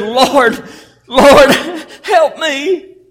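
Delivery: high, loud cry for help; acted desperate begging; desperate plea. Woman begging at Jesus' feet.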